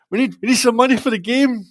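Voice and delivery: high-pitched, mock pleading kid voice; hammed-up high voice of a begging schoolboy. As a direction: plead like a small boy caught short. Boys pleading with the teacher.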